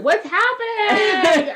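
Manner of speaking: high, slow, shouted confusion; startled shout. Retold or imitated. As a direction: yell it in mock panic. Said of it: "We're like".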